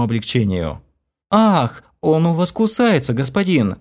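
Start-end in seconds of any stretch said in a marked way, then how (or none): 1.3-3.8 high, mildly whiny complaint; plaintive complaint. A narrator's reading. The maid pouts at her bitten hand, plaintive. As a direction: complain sweetly, a little hurt. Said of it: "жалобно пожаловалась".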